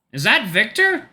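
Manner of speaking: high, eager question; excited. A narrator's reading. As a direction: burst out with excitement.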